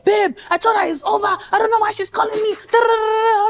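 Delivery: high, fast mock protest; pleading boyfriend. Acts boyfriend arguing back.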